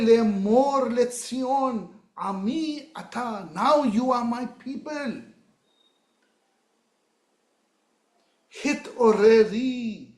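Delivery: declaiming scripture. Acted. Preacher voicing God speaking to Zion, solemn declaiming. Reads God's words.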